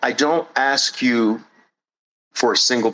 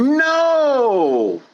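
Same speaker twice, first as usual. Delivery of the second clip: emphatic no; sharp no; 12 semitones higher than usual. Acted. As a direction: shut it down hard. Cutting off objector.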